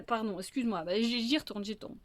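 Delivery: plain reading voice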